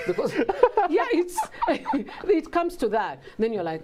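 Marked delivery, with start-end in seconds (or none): 0.9-2.2 high burst of laughter. Real, not acted. Laughing at own story.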